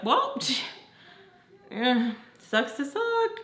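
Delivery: high, singsong mock indifference; flippant shrug. Imitating people who won't apologize, flippant. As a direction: toss it off high, not caring.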